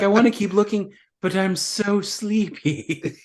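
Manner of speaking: hammed-up drowsiness with a laugh creeping in; mock sleepy heroine. Mocking a heroine dropping asleep.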